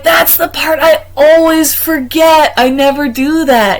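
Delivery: high, loud, slow rueful admission; eager admission. Retold or imitated. To the leader, owning up eagerly with a high voice. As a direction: admit it with a rueful laugh in the voice.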